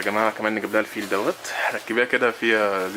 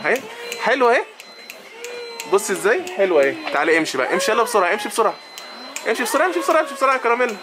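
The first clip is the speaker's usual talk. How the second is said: sing-song encouragement, much higher; 9 semitones higher than usual. Real, not acted. Coaxing baby Caramela to walk along, high cooing baby talk.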